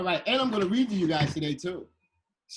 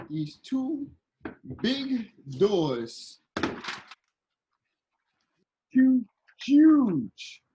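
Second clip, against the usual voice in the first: loud, high burst of amazement; awestruck emphasis; 6 semitones higher, 5 dB louder, much slower than usual. Retold or imitated. Storyteller recalling giant Louvre doors, loud awestruck emphasis. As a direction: boom out the word in wonder.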